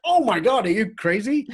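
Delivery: high, loud retold disbelief; incredulous. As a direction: replay a startled, exclaiming protest. Retold incredulous reaction to request.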